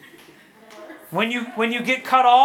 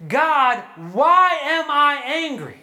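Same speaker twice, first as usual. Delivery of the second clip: slow pleading; 4 semitones higher, 3 dB louder, slower than usual. Retold or imitated. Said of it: "And I never said".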